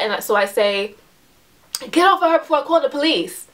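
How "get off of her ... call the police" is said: higher-pitched, upset demand; retelling an upset order to back off; upset retold warning. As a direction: call out a warning, clearly higher than usual. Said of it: "getting really upset".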